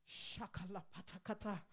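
soft murmured tongues; speaking in tongues. Real, not acted. Preacher praying softly in tongues. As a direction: drop low and pray in tongues.

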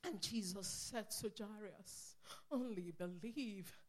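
soft, low storytelling voice; hushed retelling. Acted. Preacher softly retelling Jesus to Jairus, hushed. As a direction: hush the room and tell it gently.

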